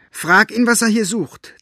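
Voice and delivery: high, loud furious hiss; hissed demand. A narrator's reading. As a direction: hiss it with fury. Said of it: "zischte Elinor".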